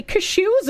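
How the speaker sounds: "cashews" said as kuh-SHOOZ; "cashews" with a weak first vowel, kuh-SHOOZ; unusual pronunciation. Real, not acted. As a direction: stress the second syllable of "cashews": kuh-SHOOZ. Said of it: "if pronounced correctly".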